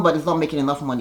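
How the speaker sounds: usual speaking voice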